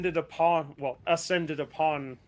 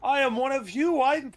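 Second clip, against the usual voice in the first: raised, higher voice pleading for order; Morthias; 6 semitones higher, 3 dB louder than usual. Acted. Morthias addressing an angry mob, raised imploring voice. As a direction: call out to calm a crowd, pitched up. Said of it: "in front of three different, or th-, a loud, angry mob, saying".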